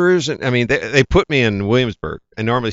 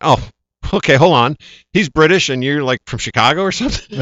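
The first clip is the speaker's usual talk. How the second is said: higher, louder, confused disbelief; baffled surprise; 6 semitones higher, 6 dB louder than usual. Retold or imitated. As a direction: sort out the puzzle aloud. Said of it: "And I went".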